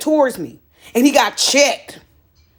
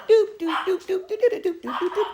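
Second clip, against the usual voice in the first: bouncy doop hops; 5 semitones higher, 6 dB quieter than usual. Retold or imitated. Hopping sound effect.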